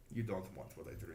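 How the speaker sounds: quiet, smug warning in an Eastern European tone; confident cocky. Retold or imitated. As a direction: say it low and hushed like he owns the room. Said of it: "in the most confident, cocky way".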